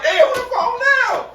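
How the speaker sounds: high, slow laughing squeal; exasperated squeal. Retold or imitated. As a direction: whine it in comic exasperation.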